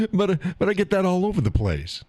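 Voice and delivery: high, laughing through the words. Real, not acted. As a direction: talk through a chuckle. Laughing at people's question.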